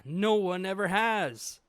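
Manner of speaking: loud, slow villain boast; slow, loud mock-villain brag; boastful boom. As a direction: proclaim it grandly, hamming it up. Eva boasting, read aloud.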